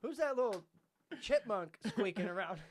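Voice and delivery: high, loud mock outrage at a recording; slowed-down, hammed-up loud disbelief; retold shocked outburst. Child hearing his recorded voice for the first time, shocked. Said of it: "and I went".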